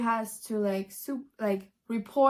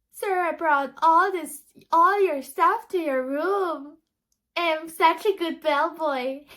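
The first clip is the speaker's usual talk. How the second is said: higher, louder proud little bellboy voice; chirpy kid showing off as a bellboy; eager bellboy kid; 6 semitones higher, 5 dB louder than usual. Retold or imitated. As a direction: puff up like a kid proud of his job. Child plays eager bellboy.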